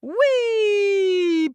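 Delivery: long, high, loud whee; flying whee. Acted. Boy butted out of the patch, squealing whee.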